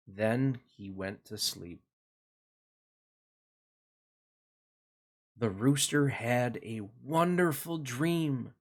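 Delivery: put-on gentle storybook narration for kids; reading to children. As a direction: read it like a bedtime picture book. Reads picture book to children.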